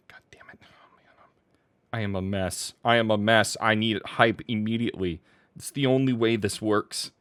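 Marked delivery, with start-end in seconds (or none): 0.1-2.0 barely audible whispered cursing; exasperated whisper; muttered frustration. Real, not acted. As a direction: curse to yourself under your breath. Frustrated muttering while typing.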